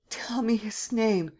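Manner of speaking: high, slow hushed plea; Gérard whispers to Sernine in fear; frightened whisper. Acted. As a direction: breathe it out in dread. Terrified Gérard bargaining.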